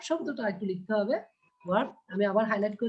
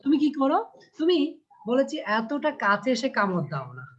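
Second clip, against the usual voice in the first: usual speaking voice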